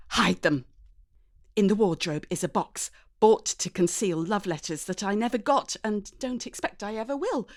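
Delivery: ordinary delivery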